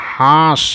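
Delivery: হাঁস with a nasalised a; nasal vowel. Shown as a demonstration. Teacher showing the different sound, nasal vowel in হাঁস. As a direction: send the a of হাঁস through the nose. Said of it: "একটু উচ্চারণটা অন্য হচ্ছে না?".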